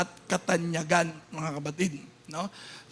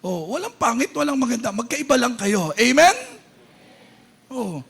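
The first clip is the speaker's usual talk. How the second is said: ordinary talk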